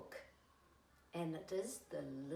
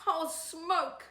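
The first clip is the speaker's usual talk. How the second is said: voicing the caboose; 7 semitones higher, 4 dB louder than usual. A narrator's reading. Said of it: "said the little red caboose".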